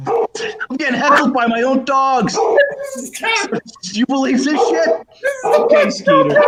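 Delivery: high-pitched comic disbelief; amused exasperation. Real, not acted. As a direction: protest the interruption, voice climbing. Heckled by own dogs mid-joke.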